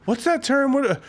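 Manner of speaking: high, lilting mom impression. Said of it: "she's like".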